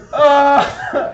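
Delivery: high, loud wailing "uh"; hammed-up sob of a grown man; mock bawling. Zebediah bawls over a doll, mock wail. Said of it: "and he's just bawling".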